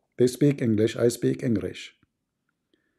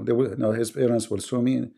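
ordinary talk, nothing marked

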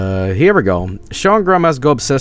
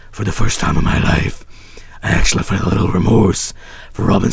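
hammed-up dark elf, half whispered; bad dark elf voice; 2 semitones lower than usual. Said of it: "I'm simply doing a really bad dark elf voice".

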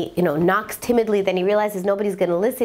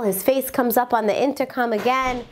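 ordinary delivery

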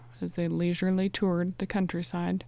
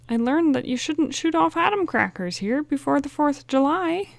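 sheepish Chet voice; 8 semitones higher, 6 dB louder than usual. A narrator's reading. Chet's rueful punchline, voiced high.

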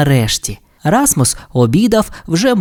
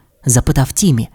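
ordinary delivery

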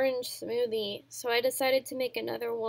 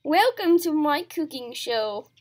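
hammed-up posh host accent, loud; a mock TV-chef accent, welcoming the audience; fake host accent; 7 dB louder than usual. Cooking show host greeting viewers in a fake accent. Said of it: "I can't fake accents".

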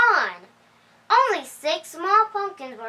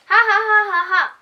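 high, loud hammed-up ha ha ha; acted storybook laugh; mocking laugh; 8 semitones higher, 6 dB louder than usual. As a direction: laugh at the silly pumpkins like a jeering fox. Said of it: "laughed the fox and the crocodile".

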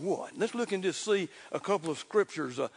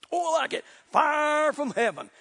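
exultant preaching; 8 semitones higher, 5 dB louder than usual. Real, not acted. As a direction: cry it out in delight to the congregation. Exulting over the miracle in sermon.

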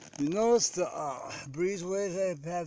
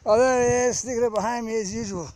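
excited dog owner; 9 semitones higher, 11 dB louder than usual. Real, not acted. Finding dog sneaking up behind.

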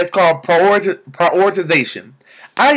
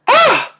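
high, loud cry of delight; joyful squeal; 5 dB louder than usual. Real, not acted. Vlogger overwhelmed by her epiphany, joyful squeal. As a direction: let out a sudden shriek of joy.

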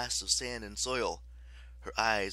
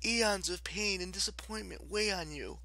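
kind, sympathetic; 9 semitones higher than usual. A narrator's reading. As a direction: lull the goddess with sympathy. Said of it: "her voice radiating kindness and sympathy".

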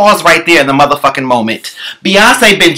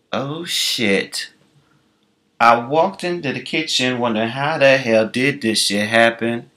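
low, soft, lost and confused; hushed bewildered murmur; dazed mumble; 6 semitones lower, 9 dB quieter than usual. Retold or imitated. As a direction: mumble it like you just woke up confused. Confused amnesiac quote, dazed.

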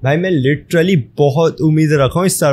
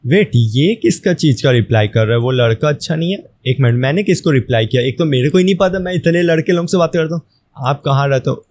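ordinary talk

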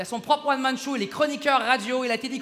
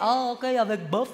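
mock Chinese waiter accent; slower than usual. Comic imitating a Chinese waiter greeting him, mock Chinese accent. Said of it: "le serveur".

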